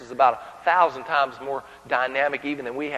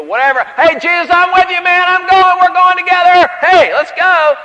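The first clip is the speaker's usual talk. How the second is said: high, loud, pumped-up promise; brash, overexcited pledge; eager loud vow; 13 semitones higher, 15 dB louder than usual. Acted. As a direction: shout your loyalty like a hype man. Peter boasting loyalty to Jesus.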